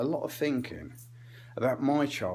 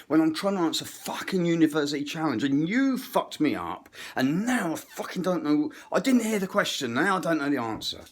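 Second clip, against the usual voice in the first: mock outburst, climbing higher and louder; venomous granddad; 4 semitones higher, 3 dB louder than usual. Comic as granddad interrupted during a quiz, exasperated rant. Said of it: "venom of a grandparent".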